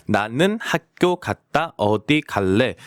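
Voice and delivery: "나는 학교 갔다" syllable by syllable; careful, separated syllables for learners to copy; slow demonstration. Shown as a demonstration. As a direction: spell out the Korean slowly to feel the tongue. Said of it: "혀가 어디 만지는지".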